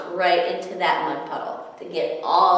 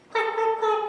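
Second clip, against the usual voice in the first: squeaky, high quack quack quack; high duck quacks. A narrator's reading. Ducklings call out to their mother in high squeaky quacks. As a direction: quack like tiny scared ducklings.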